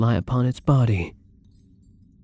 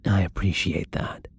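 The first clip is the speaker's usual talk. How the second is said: sincere whisper; 3 dB quieter than usual. Acted. Thanking the kind prisoner.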